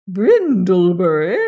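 loud, slow questioning echo; stern lady's slow repeat; disbelieving echo. A narrator's reading. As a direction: query the name with haughty disbelief. Lady echoes boy's spelt name.

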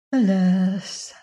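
mildly mournful, drawn-out sigh; a touch of weary sorrow. A narrator's reading. Grieving queen answering the fairy, sighing. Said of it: "sighed the queen".

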